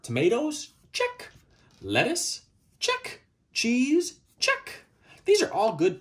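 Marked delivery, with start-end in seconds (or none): 0.9-1.3 much higher squeaky check; perky squeak of approval; high chirp. Pretend. 2.8-3.2 much higher squeaky check; perky squeak of approval; high chirp. Pretend. 4.4-4.9 much higher squeaky check; perky squeak of approval; high chirp. Pretend.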